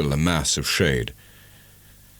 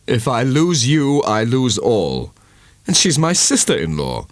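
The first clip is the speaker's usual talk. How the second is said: 1 earnest; 6 semitones higher than usual. A narrator's reading. Angel answers with feeling.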